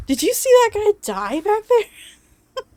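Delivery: high, loud, panicked question; frantic. Acted. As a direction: blurt it out in shock.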